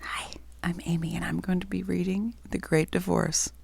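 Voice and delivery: soft whispered intro. Real, not acted. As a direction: breathe the words, barely voiced.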